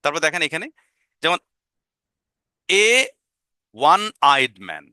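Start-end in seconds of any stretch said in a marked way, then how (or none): none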